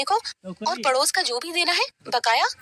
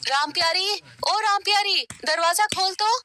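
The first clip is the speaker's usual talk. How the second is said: calling out; 7 semitones higher than usual. Acted. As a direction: call her name urgently through the door. Neighbor calling at the door at night.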